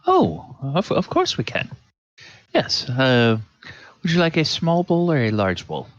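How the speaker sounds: a hint of an eager, fussy waiter; lightly voiced servant listing desserts; eager server. Server eagerly offering puddings to the lady.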